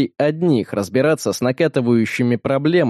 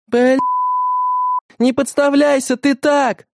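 yelling; 13 semitones higher than usual. A narrator's reading. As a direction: scream at him to stop getting hit. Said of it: "орала Бета". (орущая Бета, высоко и громко)

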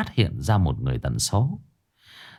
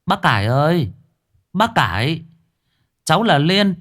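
gọi to; 8 semitones higher than usual. A narrator's reading. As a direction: call over the gate to wake her. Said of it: "Đoạn cô ngửa cổ nói to". (gọi to qua cổng)

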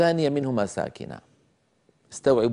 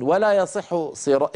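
ordinary talk

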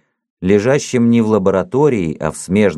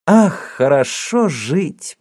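joyful sigh; 8 semitones higher, slower than usual. Acted. Hero thinks aloud, joyful.

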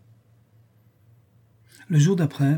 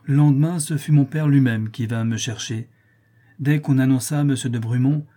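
ordinary delivery, nothing marked

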